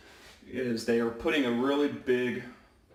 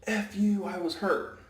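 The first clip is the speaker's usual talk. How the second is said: high mock angry retort; player cursing; 9 semitones higher than usual. Player cursing at the referee, mock angry and high. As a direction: snap it back like a sore player. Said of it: "the player goes".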